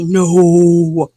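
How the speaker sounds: slow, mock-solemn no; hammed-up holy refusal; mock Jesus voice. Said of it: "in my Jesus voice".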